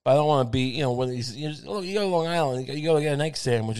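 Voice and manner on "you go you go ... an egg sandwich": usual speaking voice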